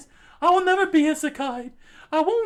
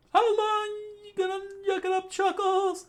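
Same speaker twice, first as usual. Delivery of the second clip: teasing higher voice; 5 semitones higher than usual. Acted. A friend teasing the laughing classmate, higher ribbing voice. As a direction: needle the laugher. A different figure teases.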